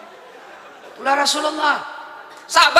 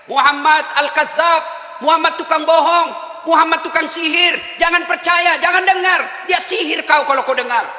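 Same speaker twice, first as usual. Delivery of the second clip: high, slow jeering yell; shouting insults; 7 semitones higher than usual. Acted. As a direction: shout it across the market. Blind man shouting insults.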